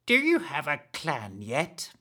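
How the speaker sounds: slow, secretive hag voice; stealthy question. Acted. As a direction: lean in and ask it slyly. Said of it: "added more stealthily".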